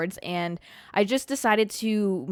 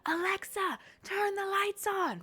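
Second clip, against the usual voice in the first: retold yell; 11 semitones higher, 7 dB quieter, slower than usual. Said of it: "I used to yell".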